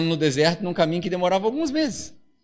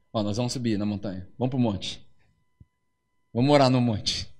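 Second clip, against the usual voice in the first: lower, softer hiding the truth; a hint of a hushed father; 10 semitones lower, 7 dB quieter than usual. Abraão calls son, hushed. (pai falando baixo com o filho, voz grave e contida)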